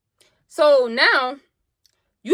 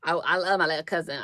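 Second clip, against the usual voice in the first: normal talking voice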